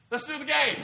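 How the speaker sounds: loud, high-pitched excited shout; eager little boys. Acted. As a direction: voice the boys jumping at the game. Boys eagerly pick the game.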